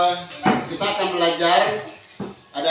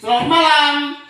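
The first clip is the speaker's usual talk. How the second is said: "Selamat pagi" called out high and loud; called greeting; 10 semitones higher, 8 dB louder than usual. Shown as a demonstration. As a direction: sing out the greeting to the class. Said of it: "belum konsentrasi". (salam "Selamat pagi" diserukan tinggi dan keras)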